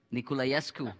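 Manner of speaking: ordinary delivery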